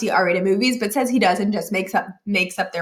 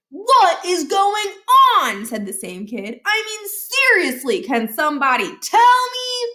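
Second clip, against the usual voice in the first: put-on a kid begging for an explanation; baffled Stuart; 9 semitones higher than usual. A narrator's reading.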